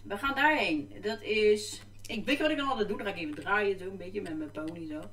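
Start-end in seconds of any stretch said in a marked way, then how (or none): none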